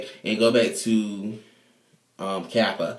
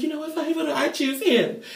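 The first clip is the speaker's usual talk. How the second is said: high huffy voice. Retold or imitated. Chanel giving in, voiced.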